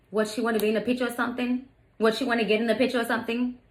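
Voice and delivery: quick, snippy imitation with attitude; mock sassy retort; worst attitude. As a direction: imitate a dismissive teen, fast. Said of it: "with the worst attitude".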